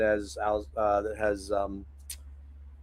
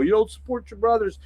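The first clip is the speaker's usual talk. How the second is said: a raised, whiny jab in a mock critic's voice; 9 semitones higher than usual. Host imitating a viewer who scolds him for not supporting his brothers. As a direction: toss off a pretend viewer's complaint in a sneering voice.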